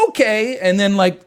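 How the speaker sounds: high, loud mock-cheerful okay; mock carefree. Imagined wife acting carefree.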